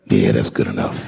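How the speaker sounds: low, laughing content shrug; low laughing shrug about the few who laughed; shrugging satisfaction. Retold or imitated. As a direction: settle for it with a chuckle. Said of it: "I'll be on stage like".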